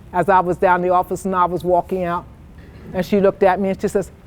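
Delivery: usual speaking voice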